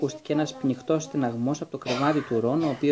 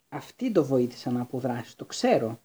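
usual speaking voice

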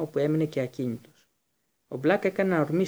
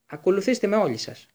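higher, louder order; commanding; 6 semitones higher, 5 dB louder than usual. A narrator's reading. Teacher ordering the group to follow him, commanding. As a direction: bark the order at everyone. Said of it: "πρόσταξε ο Σνέιπ".

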